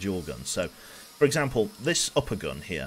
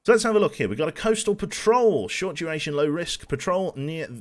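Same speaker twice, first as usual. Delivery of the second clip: much higher and louder, eager mission pick; excited reading; 7 semitones higher, 5 dB louder than usual. Real, not acted. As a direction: perk up reading the missions aloud.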